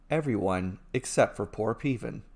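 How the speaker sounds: ordinary delivery, nothing marked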